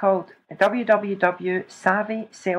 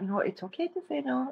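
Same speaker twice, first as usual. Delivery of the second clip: soft self-reminder; 5 semitones higher, 11 dB quieter than usual. Real, not acted. Reminding herself gently.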